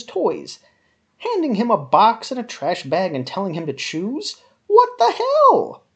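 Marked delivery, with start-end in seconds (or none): 4.7-5.8 high, loud and slow disbelief; What the hell, pitched way up and drawn out; incredulous outburst. Real, not acted. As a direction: stretch it out in baffled outrage. Outraged at Andy's mother.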